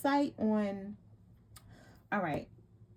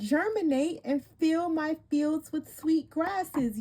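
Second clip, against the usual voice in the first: slow, solemn card reading; warm serene goddess voice; 6 semitones higher, slower than usual. Acted. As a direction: read it like a sacred invocation. Continues reading card text.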